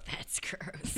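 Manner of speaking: soft, voiceless whisper; hushed whispered comment; whispered aside. Real, not acted. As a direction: whisper it under the others' talk. Disgusted aside after a gross phrase.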